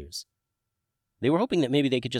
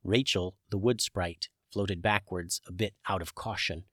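plain reading voice

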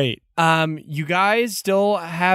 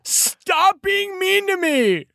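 high, drawn-out whiny wail; hammed-up hurt protest, stretched out; wailing guard; 12 semitones higher, slower than usual. Guard heckled by his prisoners, wailing in protest.

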